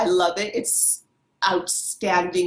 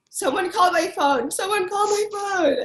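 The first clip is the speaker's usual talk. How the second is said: eager plea; 12 semitones higher than usual. Retold or imitated. Eager to show her ringtone.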